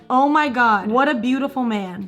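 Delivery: normal talking voice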